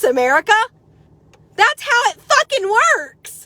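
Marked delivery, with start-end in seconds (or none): 1.5-3.4 high, slow and emphatic; heated rant. Real, not acted. Ranting at Facebook critics, slow heated emphasis. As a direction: hammer home each word slowly.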